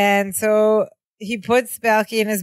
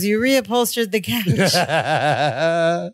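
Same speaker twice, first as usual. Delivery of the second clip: slow mock Marianne; 3 semitones lower, much slower than usual. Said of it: "She goes".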